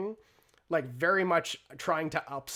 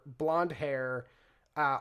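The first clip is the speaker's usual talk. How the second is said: normal talking voice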